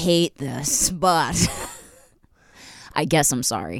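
slow, low reluctant admission; grudging apology. Acted. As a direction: drag it out like a sulky apology. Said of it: "She'll be like".

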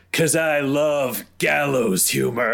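hammed-up one-liner. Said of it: "then I yell down at him".

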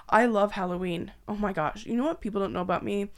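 normal talking voice

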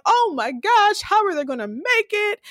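high-pitched retold worry; dramatized viewer's panic; alarmed. Own alarmed reaction, retold.

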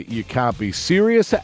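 ordinary talk

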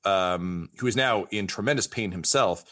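normal talking voice

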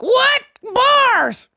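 high, loud hype yelling at a killer line; hyped shout. Real, not acted. Host hyping a guest's lyric, shouting it up high. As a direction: scream it like the bar just floored you.

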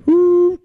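very high, loud whistle; train whistle. Retold or imitated. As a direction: blow it like a steam whistle. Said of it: "He pulls it up".

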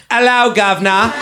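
mock posh British greeting; lightly put-on British voice; British accent. Married friend flirting at a bar, a hint of British accent. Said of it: "are you British?".

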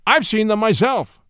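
judge's snap, high and loud; snapped retort. A narrator's reading. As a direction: snap back at the witness. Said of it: "snapped the justice".

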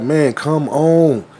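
drawn-out, slow mock complaint; retold impatient kid. Kid calling across the street, imitated impatience. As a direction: stretch it out like an exasperated kid.

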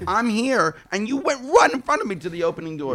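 mock outrage at a woman cutting in at the subway; indignant. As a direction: act offended by the line-cutter. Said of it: "I'm like".

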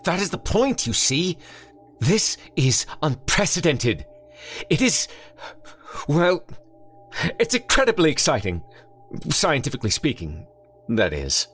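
high, quickening scientific thrill; excited high doctor. A narrator's reading. Doctor thrilled to the detective about a werewolf, high and excited. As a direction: gush like a giddy scientist.

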